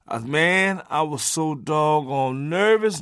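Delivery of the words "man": ordinary talk, nothing marked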